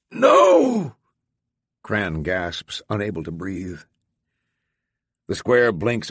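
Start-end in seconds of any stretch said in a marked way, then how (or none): 0.1-1.0 sudden high loud cry; shout. Acted. Character's panic at danger warning.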